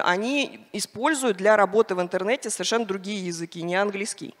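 ordinary talk, nothing marked

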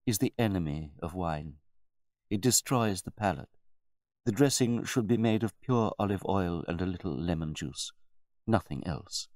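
understated butler correctness; measured butler. A narrator's reading. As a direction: explain it patiently to the master. Butler lectures his master.

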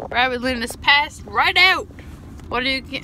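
ordinary talk

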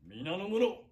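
loud, slow stage declamation as a samurai lord; theatrical feudal lord. Acted. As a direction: proclaim it like a warlord on stage. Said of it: "再現お芝居でござった".

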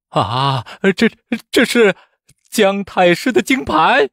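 high, slow, frightened halting voice; terrified stammer. A narrator's reading. Examiner recognising the Grand Preceptor's plaque, terrified stammer. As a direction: gasp and stumble in sudden dread. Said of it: "吓得魂不附体，颤声道".

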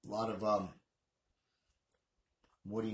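speaking around cigar; cigar in mouth. Real, not acted. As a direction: lips pursed on cigar. Seen 0: Unlit cigar held between lips through most words, lowered near end.

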